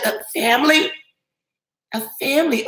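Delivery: light mock Australian twang on family; playful fake Australian accent. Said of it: "in a very typical Australian accent".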